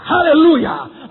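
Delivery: loud, high, drawn-out hallelujah; exultant cry. Acted. Believer suddenly bursts with joy, exultant cry. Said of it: "Dan tiba-tiba kau berkata".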